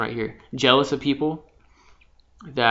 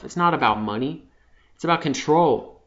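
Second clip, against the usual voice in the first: ordinary talk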